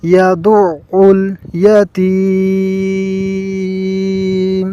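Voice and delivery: slow melodic tajweed chant; chanted Quran verse; Quran recitation. Shown as a demonstration. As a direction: recite it like a qari, drawn out.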